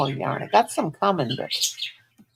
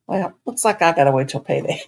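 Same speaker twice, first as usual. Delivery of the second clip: laughing through the words; amused resigned laugh; rueful laugh; 3 semitones lower, 5 dB louder than usual. Real, not acted. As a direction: chuckle it out ruefully. Laughing at own finances.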